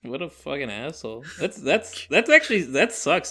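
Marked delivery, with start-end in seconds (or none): 1.4-3.3 outraged. Real, not acted. Outraged at the teacher.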